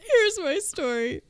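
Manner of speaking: high, loud laughing; cracking up, barely able to speak; laughing hard. Real, not acted. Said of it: "I'm dead".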